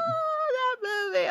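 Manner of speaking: getting teary over the movie; choked up. Real, not acted. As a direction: voice cracking with emotion. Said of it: "I'm getting emotional".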